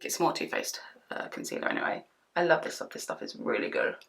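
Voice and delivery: whispered product aside mid-story. Real, not acted. Beauty vlogger whispering her love for the concealer to viewers. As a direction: breathe it without voice, close to the mic.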